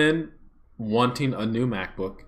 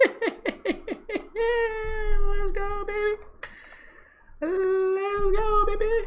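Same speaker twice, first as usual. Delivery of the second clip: high squeaky cheer, much higher; falsetto hype. Real, not acted. Streamer cheering the new MacBook reveal, high falsetto.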